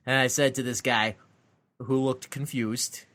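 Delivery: ordinary talk, nothing marked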